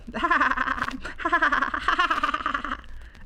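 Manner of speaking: high, long animal giggle; laughing out loud. Acted. Young llama finds the sea lions funny, laughing loudly. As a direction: let out peals of high laughter. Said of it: "He laughed out loud".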